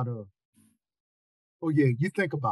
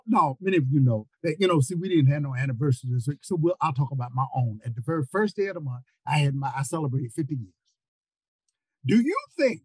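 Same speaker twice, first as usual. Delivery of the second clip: ordinary talk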